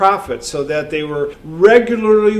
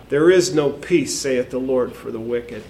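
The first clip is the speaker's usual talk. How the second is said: much lower and softer, sinking at the end; solemn hush; 8 semitones lower, 6 dB quieter than usual. Acted. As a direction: let the verdict fall quietly. Preacher quoting a solemn verdict.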